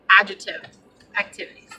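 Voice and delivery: normal talking voice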